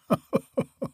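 breathy mocking dragon chuckle; soft dragon chuckle; dragon laugh. A narrator's reading. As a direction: laugh at her foolishness. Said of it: "the dragon laughed the more".

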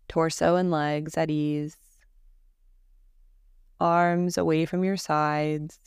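calm, even Yoga Nidra guidance with long pauses; guided meditation. Acted. Teacher guiding listeners through Yoga Nidra practice, calm guided-meditation voice. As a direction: lead them gently into rest, leaving space after each phrase.

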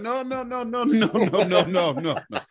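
high-pitched, half-laughing string of refusals; hurried protest. Retold or imitated. Officer retelling correcting an over-friendly driver, in high mock protest. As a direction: wave it off in a high voice, amused.

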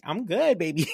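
high, slick mock-cool brush-off; smooth cool guy. Brushing off the thug son, mock-cool. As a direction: play it like a cocky dude waving them off.

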